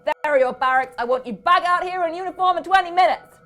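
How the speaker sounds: loud, high commands; gruff colonel barking; shouted orders. A narrator's reading. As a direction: order them off to barracks.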